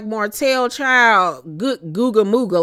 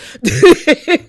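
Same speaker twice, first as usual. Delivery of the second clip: short laugh; 6 semitones higher, 8 dB louder than usual. Real, not acted. Laughs at quivering voice.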